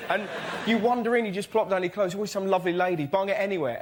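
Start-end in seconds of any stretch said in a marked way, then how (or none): none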